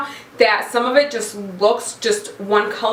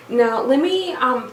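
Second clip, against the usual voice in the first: ordinary talk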